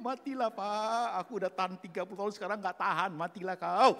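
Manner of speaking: hammed-up spouse at breaking point; acted fed-up spouse. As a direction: build to a loud final yell. Acting wife choking husband.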